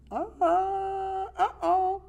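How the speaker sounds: very high-pitched mock alarm; high little voice of dawning trouble. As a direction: squeak out a comic uh-oh. Acting out a listener's alarm.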